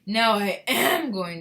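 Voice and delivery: loud, slow yell stretching AM; queasy yell. A narrator's reading. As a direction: yell it, leaning hard on am. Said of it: "yelled Missus Teavee".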